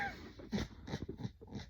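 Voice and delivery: amused burst of laughter; laughing. Real, not acted. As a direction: crack up without words. Amused by finger gesture remark.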